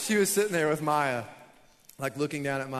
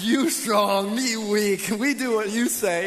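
lightly hammed-up cowed reply, louder and higher; mock submissive caveman; 5 semitones higher, 8 dB louder, slower than usual. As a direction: surrender in broken caveman talk. Comic retelling of cowed reply to wife.

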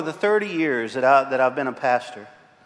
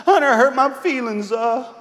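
put-on higher whiny child's voice; upset little girl; 6 semitones higher than usual. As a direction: complain like a hurt five-year-old.

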